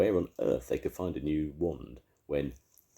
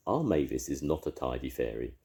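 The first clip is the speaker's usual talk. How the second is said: ordinary delivery, nothing marked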